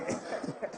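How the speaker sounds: soft voiceless chuckle; laughter. Real, not acted. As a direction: laugh quietly, almost all air. Reacting to the banter.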